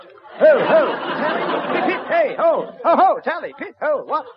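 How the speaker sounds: high, loud hunting cry; hammed-up cheering. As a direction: whoop it like a fox hunt.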